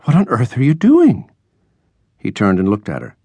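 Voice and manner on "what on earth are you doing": high, astonished exclamation; aghast. A narrator's reading. Landlady finds him slashing his drawing, aghast. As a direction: demand it in shocked disbelief. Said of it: "gasped Missus Keating".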